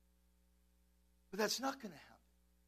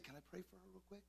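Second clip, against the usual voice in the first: very soft, quickened murmur; gentle request; 16 dB quieter, faster than usual. Acted. Pastor asking to pray for a girl, gently. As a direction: ask it gently, barely voiced. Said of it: "but just gently said".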